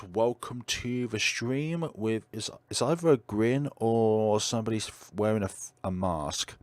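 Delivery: ordinary delivery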